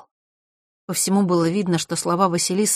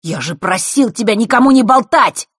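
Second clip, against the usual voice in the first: higher, louder angry reproach; furious accusation, raised voice; 6 semitones higher, 8 dB louder than usual. A narrator's reading. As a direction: snap at the betrayer. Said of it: "и тут же разозлился".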